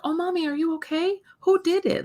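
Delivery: put-on high little-kid voice, protective and worried; a small boy rushing to protect mommy; concerned toddler. Mom imitating her son after she stubs her toe, high worried child voice. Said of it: "he's like".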